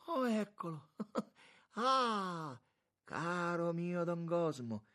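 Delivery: loud, very high, effusive welcome; drawn-out, exuberant monsignor's greeting; joyful greeting. A narrator's reading. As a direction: hail an old friend with delight. Monsignor joyfully greets old friend.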